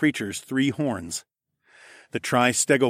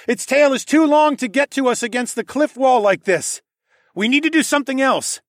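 high, loud pressing explanation; urgent calling out; 13 semitones higher, 6 dB louder than usual. A narrator's reading. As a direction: shout the problem from behind cover. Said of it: "I called out".

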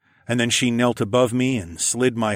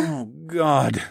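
raised, weary moan; 6 semitones higher than usual. Acted. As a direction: moan it, spent. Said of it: "I moaned".

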